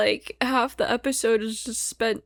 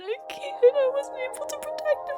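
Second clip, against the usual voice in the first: real sobbing, voice high and breaking, with long sighs; weeping through the words, barely holding together; crying. Real, not acted. Watching Violet's sad scene, sobbing in a much higher voice. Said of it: "The reaction is just me crying".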